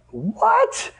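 sky-high squeaky disbelieving what; the price just floored you; surprised. Retold or imitated. Retold shock at cheap tickets.

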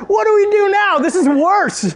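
high, loud frantic cry; mock panic. Child to mom on stormy shore, mock panic. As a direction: imitate a panicked kid, voice way up.

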